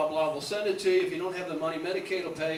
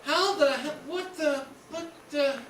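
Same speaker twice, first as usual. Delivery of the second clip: put-on confused kid; 6 semitones higher than usual. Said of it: "you'd go".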